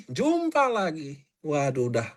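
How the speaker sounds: usual speaking voice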